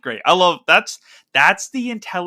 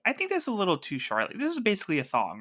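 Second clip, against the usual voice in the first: a hint of character voice, higher and softer; lightly voiced Harley; 7 semitones higher, 5 dB quieter than usual. Co-host retelling Harley on the Robin suit, lightly voiced. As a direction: quote her in a light, raised voice.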